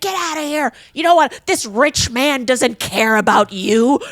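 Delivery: high, loud, sneering movie villainess; mock villain lady. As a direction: ham up the cruel matron throwing her out. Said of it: "So the villain lady comes in".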